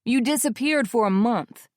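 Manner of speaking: scolding. Acted. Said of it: "Corey scolds".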